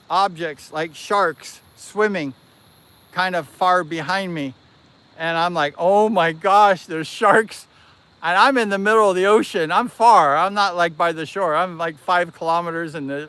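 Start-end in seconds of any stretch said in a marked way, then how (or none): 5.8-7.7 slow, louder "oh my gosh" of dread; retold alarm, drawn out at first. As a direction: act out spotting danger behind you. Acts out alarm on seeing sharks.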